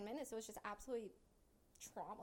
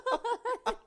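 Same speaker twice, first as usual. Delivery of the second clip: much louder and higher, laughing hard; howling with laughter; 11 semitones higher, 14 dB louder, faster than usual. Real, not acted. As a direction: lose it completely. Listener cracking up.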